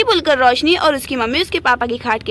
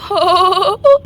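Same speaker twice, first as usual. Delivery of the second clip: hearty laughing; 2 semitones higher, 4 dB louder than usual. Acted. Uncle laughing at the girl's remark, hearty ho-ho. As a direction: round "ho ho" laugh. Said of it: "आप इतना ज्यादा क्यों हंस रहे हैं".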